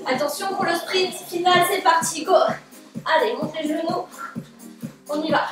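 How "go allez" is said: usual speaking voice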